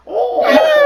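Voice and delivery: very high, loud mock screech. Imagining the ghost as enemies come, piercing screech. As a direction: screech shrilly over the top. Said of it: "it would just be screeching".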